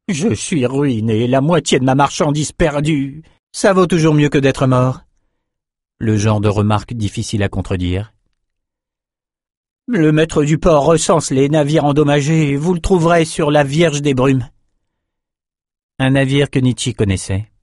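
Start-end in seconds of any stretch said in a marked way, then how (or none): 0.1-5.0 high-pitched whining merchant. A narrator's reading. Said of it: "c'était l'heure de pleurnicher". 9.9-14.5 higher-pitched, put-upon merchant voice; whining merchant. A narrator's reading.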